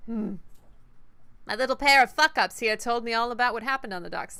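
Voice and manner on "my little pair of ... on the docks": a hint of a New York accent; light New York gangster lilt; a little New York. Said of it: "See, that's a little New York".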